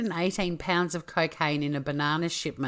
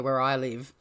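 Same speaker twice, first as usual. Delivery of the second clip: usual speaking voice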